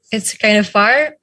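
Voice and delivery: ordinary delivery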